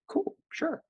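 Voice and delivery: squeaky high, soft; fake-cool squeak; mock casual. Playing it cool on phone.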